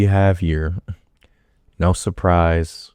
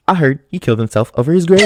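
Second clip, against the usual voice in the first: quick, slightly higher mock voice of a dim minion; idiot's voice; 7 semitones higher, 4 dB louder, much faster than usual. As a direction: play the clueless sidekick, blurting it out fast. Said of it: "I gotta do this idiot's voice right".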